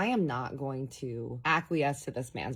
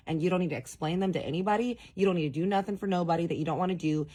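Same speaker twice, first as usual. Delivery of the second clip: usual speaking voice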